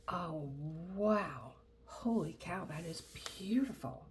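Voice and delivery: admiring whisper. Real, not acted. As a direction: breathe it out in awe. Admiring the kit contents.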